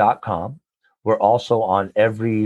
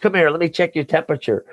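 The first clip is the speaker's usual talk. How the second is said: pitched way up and fast, mock disbelief; dad can't believe the kid helped, jokingly; a hammed-up stunned father; astonished dad; 6 semitones higher, faster than usual.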